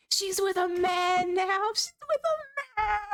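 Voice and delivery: high, cracking mock sob; mock crying. Mock heartbreak over lost girl.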